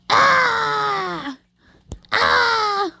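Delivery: short high yelps of pretend panic; mock scream. Gamer in a crashing plane game, short mock screams. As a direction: yelp like the plane is going down.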